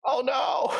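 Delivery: high-pitched cry of dismay. Real, not acted. Player sees the failed roll, high dismayed cry. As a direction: wail it way up high at a bad outcome.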